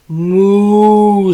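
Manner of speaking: a drawn-out mooing cow. A narrator's reading. Cow mooing at Turkey, loud drawn-out moo. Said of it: "said Cow".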